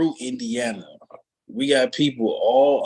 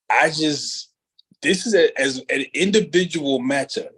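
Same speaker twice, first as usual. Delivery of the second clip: ordinary talk, nothing marked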